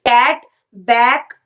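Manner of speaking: slow, loud "कैट, बैक" to show the a; teacher modelling a vowel; short a sound. Shown as a demonstration. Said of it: "ये अ की आवाज निकाल रहा है".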